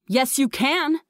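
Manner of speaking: high, insistent mother; commanding, without shouting; ordering. A narrator's reading. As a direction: order your child firmly. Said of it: "she ordered".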